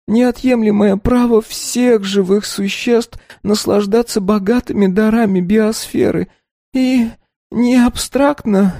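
muffled, face in the tablecloth; head on desk. A narrator's reading. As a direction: mumble with face pressed to table. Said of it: "уткнувшись лицом в скатерть".

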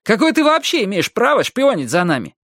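high-pitched outraged challenge; indignant flare-up. A narrator's reading. Майки challenges the Russian for spying, indignant. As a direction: attack with rising outrage. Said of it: "вскинулся Майки".

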